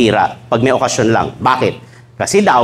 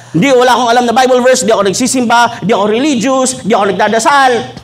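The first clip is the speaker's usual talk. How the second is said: mock defiant boast; 6 semitones higher than usual. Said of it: "nagpo-post pa sa Facebook".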